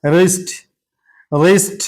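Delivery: रिस्ट starting straight on the R; silent W. Shown as a demonstration. Teacher models wrist for learners, रिस्ट with silent W. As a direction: say रिस्ट with no W sound. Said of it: "डब्ल्यू silent है".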